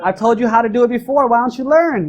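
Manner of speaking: laughing mock rant; annoyed rant. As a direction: scold her for not knowing Uber.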